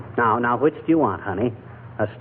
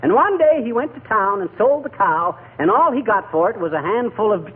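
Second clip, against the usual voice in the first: higher, sing-song fairy tale telling; storytelling voice; 6 semitones higher than usual. Acted. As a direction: tell a tale to a sick child.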